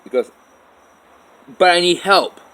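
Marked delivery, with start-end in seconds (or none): 1.6-2.4 high, shouted protest; loud retold plea. Mother insists to dad she needs help, shouting. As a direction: cry out for help, acting it out.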